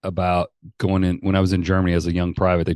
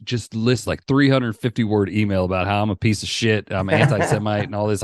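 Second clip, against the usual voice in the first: ordinary talk, nothing marked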